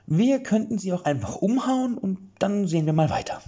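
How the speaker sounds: high, casual, offhand suggestion; Killer Wollmaus voice. A narrator's reading. Killer Wollmaus shrugging at Erik with her club, high and offhand. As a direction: toss off a plan to just knock them out.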